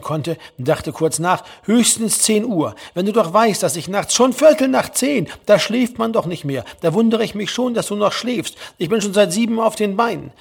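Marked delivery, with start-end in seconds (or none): 1.6-10.3 higher-pitched mother crowing over her son; triumphant one-upping. A narrator's reading. Mother boasting to sleepy son she rose early, triumphant. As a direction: score points with pitched-up, emphatic scolding. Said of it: "auf eine so auftrumpfende Weise".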